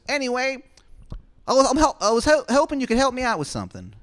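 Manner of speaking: very high, loud, quick falsetto; Shithead Steve asking. Acted. Steve asking Adam for a favor, fast high falsetto. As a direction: rush the request up high. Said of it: "He goes".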